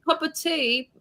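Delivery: imitation British kid asking mum; British accent. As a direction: beg sweetly in put-on British. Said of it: "They say mom".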